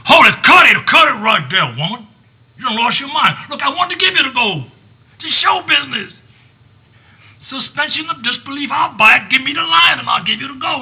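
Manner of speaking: high, loud angry outburst; king yelling at his daughter to cut it; furious king. Acted. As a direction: stop the scene with a roar.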